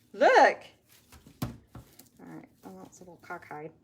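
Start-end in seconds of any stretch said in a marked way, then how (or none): 0.1-0.6 excited look. Real, not acted. Showing viewers the result.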